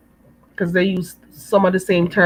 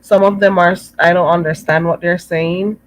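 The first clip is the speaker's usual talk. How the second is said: usual speaking voice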